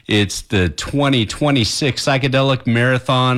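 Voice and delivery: usual speaking voice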